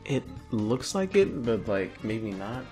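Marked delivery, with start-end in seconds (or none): none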